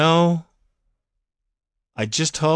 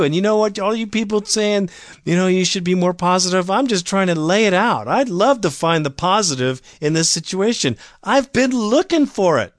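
worked-up rant; 7 semitones higher than usual. Real, not acted. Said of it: "I'm sorry I got emotional".

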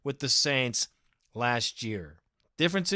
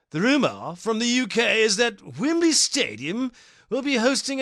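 put-on higher-pitched, hammy British accent; 6 semitones higher than usual. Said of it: "please do it in your finest British accent".

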